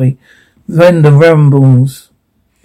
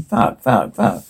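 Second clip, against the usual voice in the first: low, sinking, softened swearing; hushed cursing; 7 semitones lower, 8 dB quieter, slower than usual. Acted. Character panting among the birds, hushed cursing. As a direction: swear under your breath in dread.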